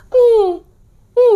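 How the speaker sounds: high, wailing hum as if crying; possessed moaning. Retold or imitated. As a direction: moan like someone possessed, high and tearful. Possessed sister-in-law moaning again. (rintihan tinggi seperti kesurupan)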